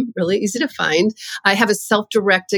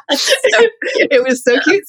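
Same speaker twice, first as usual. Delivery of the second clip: slow, laughing through the words; delighted laugh about her son; 8 semitones higher, much slower than usual. Real, not acted. Laughing at son's preschool story.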